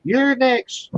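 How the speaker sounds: high mock old lady voice, fussing over a single guy. Teasing the single guy at a wedding, high mock auntie voice. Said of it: "them old fucking women".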